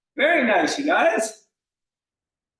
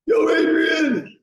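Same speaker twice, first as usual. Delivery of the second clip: Rocky shout; 8 semitones higher, much slower than usual. Retold or imitated. Coach hamming up Rocky for the class, slow shout. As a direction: belt it out like Rocky calling for Adrian. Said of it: "a bunch of little Rockies".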